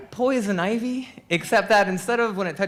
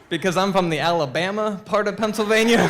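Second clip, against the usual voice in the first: ordinary delivery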